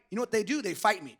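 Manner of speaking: ordinary talk, nothing marked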